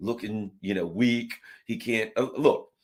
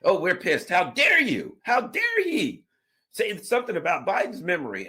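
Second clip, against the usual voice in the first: much higher, louder, hammed-up indignant shout; mock outrage; 10 semitones higher, 5 dB louder than usual. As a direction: parody the offended officials at full pitch. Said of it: "the Biden administration's like".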